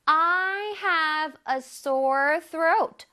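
very slow, careful English; slow teacher demo; slow model reading. Shown as a demonstration. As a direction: read each word slowly for students. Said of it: "문장에서 한번 볼게요".

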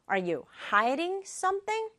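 ordinary talk, nothing marked

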